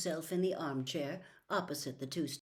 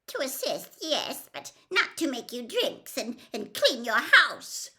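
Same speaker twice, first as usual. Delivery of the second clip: squeaky Wormtail voice; 7 semitones higher, 7 dB louder than usual. A narrator's reading.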